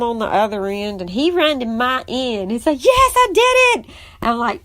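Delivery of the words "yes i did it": high, loud victory yell; triumphant cheer. Retold or imitated. Stepson runs to stepmom after first wrestling win, triumphant. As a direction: crow it like a kid who just won.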